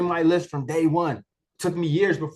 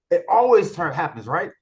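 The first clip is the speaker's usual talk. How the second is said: usual speaking voice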